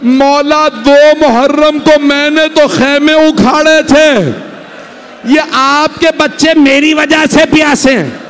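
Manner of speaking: anguished plea. Acted. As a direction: plead with a breaking heart. Hur's remorseful plea. (हुर की ऊँची, दर्दभरी फ़रियाद)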